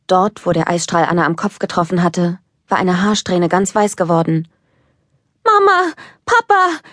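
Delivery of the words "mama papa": high, loud call for help; a frightened child calling her parents; desperate call. Acted. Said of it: "rief Elsa verzweifelt". (verzweifelt und laut rufend)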